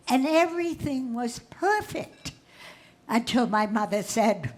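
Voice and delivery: ordinary talk, nothing marked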